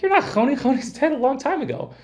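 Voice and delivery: high, lightly voiced dismissive townsfolk; scoffing townspeople. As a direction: brush him off in a high voice. Townspeople rejecting Choni, story.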